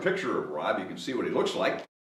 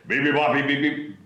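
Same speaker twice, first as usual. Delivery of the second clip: mild mock bellow; 2 semitones higher than usual. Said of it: "bellowed the bears".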